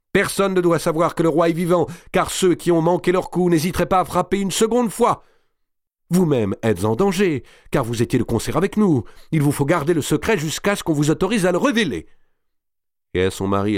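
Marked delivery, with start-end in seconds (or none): 0.1-12.1 very high, louder urgent warning; urgent exclaiming. A narrator's reading. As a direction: press the secret on her, crying out. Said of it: "s'écria madame de Bouville". (mise en garde pressante, voix aiguë)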